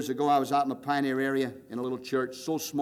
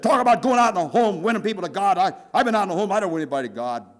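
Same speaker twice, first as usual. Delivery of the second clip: louder and higher complaint; loud outburst; 5 semitones higher, 7 dB louder, faster than usual. Retold or imitated. Pastor's wife complaining to the preacher, loud higher outburst. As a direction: throw the frustration back at the teacher.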